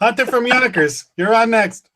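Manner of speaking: high, bright call-in show host; radio host voice. Pretend. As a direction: introduce a caller like a DJ. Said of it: "like we're on a radio show".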